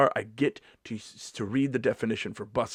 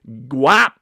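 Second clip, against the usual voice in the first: loud slang; 11 dB louder than usual. Pretend. Slang announced.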